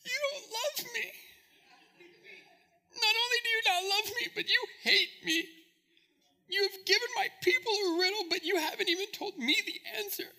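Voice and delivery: high, tearful, sobbing plea; crying wife. Acted. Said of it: "came to him in tears and said".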